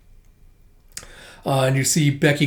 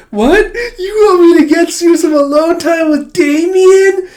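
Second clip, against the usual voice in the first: very high, loud gush; thrilled squeal; 14 semitones higher, 9 dB louder than usual. Retold or imitated. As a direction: gush like a romance-crazed schoolgirl. Becky thrilled by romance.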